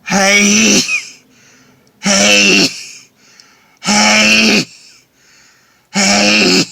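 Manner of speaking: loud sneezes in a row; sneezing fit from pepper. Real, not acted. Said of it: "feel the pepper".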